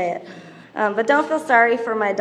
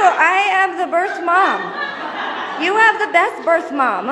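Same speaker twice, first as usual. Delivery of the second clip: laughing retort; 7 semitones higher, 5 dB louder, slower than usual. Retold or imitated. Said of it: "I go".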